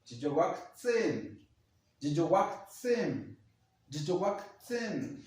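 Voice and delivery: Yiyuwaktzin very slow as jiowaktsen, falling; slow dji-yowaktsen variant of Yiyuwaktzin. Shown as a demonstration. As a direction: draw each Yiyuwaktzin out slowly. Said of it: "Y la otra sería".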